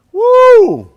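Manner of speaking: very high, loud "woo"; loud whoop. Real, not acted. Teacher reacting to an uncovered yawn, loud whoop. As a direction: let out a big high whoop.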